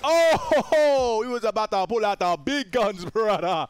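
hammed-up taunting. Gamer stopping a foe pulling out big guns, loud put-on taunt.

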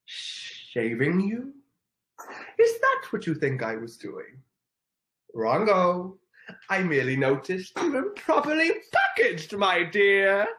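milk the Grinch's smug scorn; Grinch impression. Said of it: "Oh, I'll do it again".

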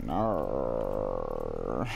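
low, drawn-out growl; long rolling "arrgh" deep in the throat; mock growl held low and steady; playful growl. Noise after praising the nurse.

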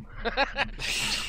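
a boozy pub-style guffaw; pub laugh. Real, not acted. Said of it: "the sort of pub laugh at the end of that".

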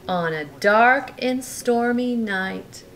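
lively bedtime storybook reading; reading to a child. A narrator's reading. Parent reading a bedtime story to Brooklyn, lively. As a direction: read it like a bedtime story to a little one. Reading picture book aloud.

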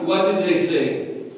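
usual speaking voice

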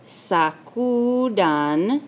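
"sacudan" drawn out syllable by syllable; slow dictation. Shown as a demonstration. Teacher saying the word while writing it, "sacudan" drawn out. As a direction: stretch each syllable of "sacudan" very slowly.

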